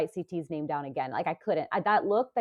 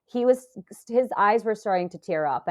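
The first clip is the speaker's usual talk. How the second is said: ordinary talk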